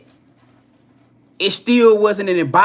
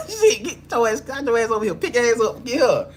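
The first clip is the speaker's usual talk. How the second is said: laughing mock command; 5 semitones higher than usual. Laughing playful partner voice.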